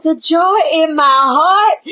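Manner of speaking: slow, crying joyful voice; overwhelmed, tearful happiness. Retold or imitated. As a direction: choke up with joy. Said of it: "She was like".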